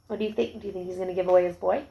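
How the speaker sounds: plain reading voice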